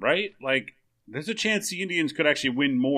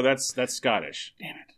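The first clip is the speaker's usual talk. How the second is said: normal talking voice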